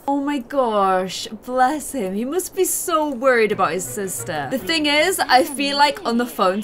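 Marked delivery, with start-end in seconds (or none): none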